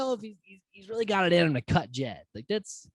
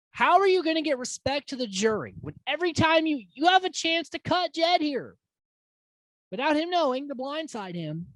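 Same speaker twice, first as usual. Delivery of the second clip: high-pitched exasperated rant; frustrated challenge, voice pitched up; real frustration; 10 semitones higher than usual. Real, not acted. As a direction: complain in a raised pitch, exasperated. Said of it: "so frustrating".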